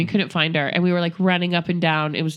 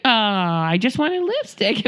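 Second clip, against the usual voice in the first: pleading child imitation; slower than usual. Daughter excusing herself to parents, mock pleading.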